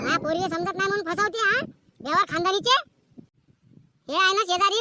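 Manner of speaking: usual speaking voice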